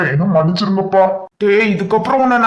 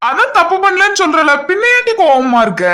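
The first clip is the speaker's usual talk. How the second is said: high whiny plea; 9 semitones higher than usual. Acted. Husband pleads with wife.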